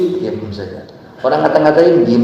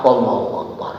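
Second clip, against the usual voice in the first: ordinary talk, nothing marked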